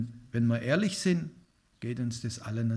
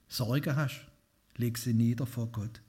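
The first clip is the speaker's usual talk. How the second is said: usual speaking voice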